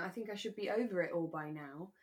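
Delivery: ordinary talk, nothing marked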